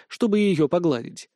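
ordinary delivery, nothing marked

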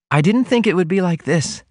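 high heroine voice, marvelling; wondering heroine. A narrator's reading. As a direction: answer your lover in a lifted voice. Heroine answers about love.

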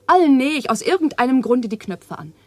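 ordinary delivery

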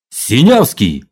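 loud barked name. A narrator's reading. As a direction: bark the name like an order. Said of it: "гаркнул сержант".

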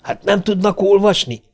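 high, indignant outburst; engineer's mock yell, not very loud; restrained yell. A narrator's reading. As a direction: yell at intruders without full volume. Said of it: "üvöltötte".

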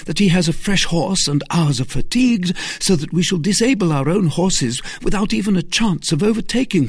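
high, loud, pressing argument; urgent persuasion. Acted. Aramis holding back D'Artagnan, urgent and insistent. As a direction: reason with him urgently. Aramis argues against the chase.

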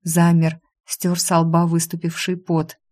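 usual speaking voice

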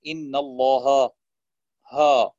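الله ending in a dark, back ها; heavy haa. Shown as a demonstration. Teacher showing a mistake, الله with a heavy haa. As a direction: thicken the haa after the heavy lam of الله. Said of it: "مثلًا تفخيم الهاء في اسم الجلالة".

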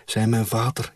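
ordinary delivery

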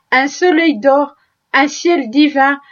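usual speaking voice

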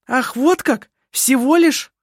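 high, derisive retort; indignant, sneering exclamation; scornful snort. A narrator's reading. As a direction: throw it back with loud scorn. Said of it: "фыркнула Керстин".

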